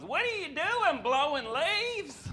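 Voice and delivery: lightly voiced nosy neighbour asking the obvious. Neighbour walking up to the driveway, high drawn-out question.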